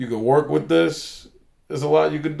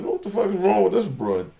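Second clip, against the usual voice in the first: indignant retort; 7 semitones higher than usual. Retold or imitated. Retelling indignant reaction to receptionist.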